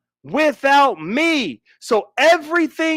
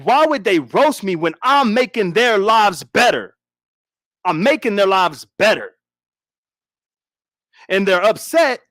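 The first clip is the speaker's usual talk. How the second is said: ordinary delivery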